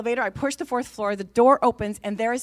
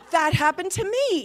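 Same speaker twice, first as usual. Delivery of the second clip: high, loud, excited exclamation; astonished recognition; 7 semitones higher, 5 dB louder than usual. Retold or imitated. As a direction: burst out amazed at the coincidence. Astonished at the same experience.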